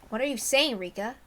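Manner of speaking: plain reading voice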